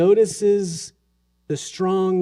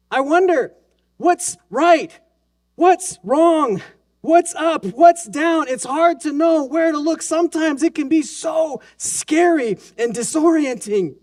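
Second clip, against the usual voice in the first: impassioned preaching; 8 semitones higher than usual. Acted. Preacher voicing the world's confusion to the congregation, higher and urgent. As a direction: press each question harder.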